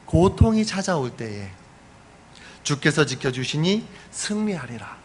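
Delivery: ordinary talk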